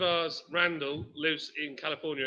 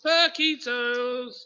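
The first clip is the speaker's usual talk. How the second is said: stretched out, higher and louder; excited shout; 7 semitones higher, 8 dB louder, much slower than usual. Real, not acted. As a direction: call it out with delight. Excited cry of snack name.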